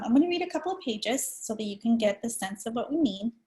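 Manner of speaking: usual speaking voice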